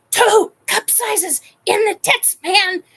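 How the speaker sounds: high, slow, loud complaint; very loud outburst. Retold or imitated. Client protests to her trainer, loud outburst. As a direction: blurt it far too loudly. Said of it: "I didn't realize I had said it so loud".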